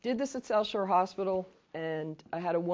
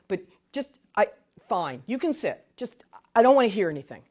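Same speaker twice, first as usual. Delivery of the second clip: mock irritated, louder at the end; grudging consent; 3 semitones higher, 8 dB louder than usual. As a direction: act out giving in, then shutting them down. Acting a role-player grudgingly giving in.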